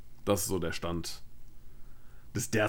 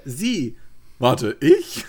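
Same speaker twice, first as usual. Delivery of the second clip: startled disbelief; 4 semitones higher, 8 dB louder, much slower than usual. Retold or imitated. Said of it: "und dann direkt so".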